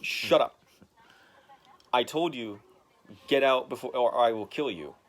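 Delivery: loud angry threat; mock threat. Daredevil threatening the lawyer, loud. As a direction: snap him shut. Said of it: "He's like".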